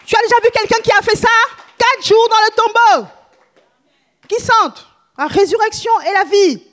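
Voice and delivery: fervent preacher exclaiming; preaching at full force. Real, not acted. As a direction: whip up the crowd with awe.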